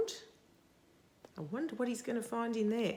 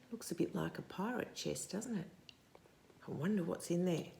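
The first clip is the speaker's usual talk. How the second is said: ordinary delivery, nothing marked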